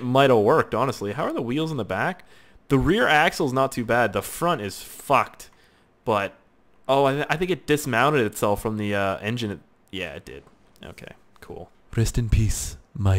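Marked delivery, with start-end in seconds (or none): none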